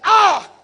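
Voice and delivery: sharp loud alarmed cry of "Ah!"; sudden panicked exclamation; startled shout. Retold or imitated. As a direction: yelp as if bracing for bad news. Alarmed at mentee's news.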